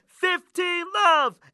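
high, drawn-out sideline scream. Acted. Kid on the sideline cheering Venus on, screaming. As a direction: yell it slowly, way up in pitch. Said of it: "I'm standing on the sideline screaming".

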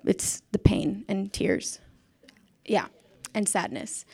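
usual speaking voice

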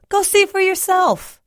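high, slow, insistent challenge; assertive. Acted. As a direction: dare him to go look. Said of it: "I asserted".

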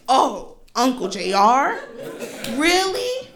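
drawn-out questions climbing high, imitating an incredulous uncle; mock disbelief. Uncle hears who bought the rat, mock disbelief.

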